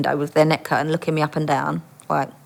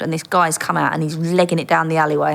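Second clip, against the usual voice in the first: ordinary delivery, nothing marked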